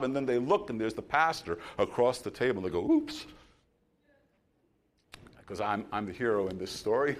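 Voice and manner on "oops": tiny, very high-pitched oops; caught-out oops. Retold or imitated. Diner spots the pastor across the table, sheepish oops. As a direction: squeak it like someone caught misbehaving.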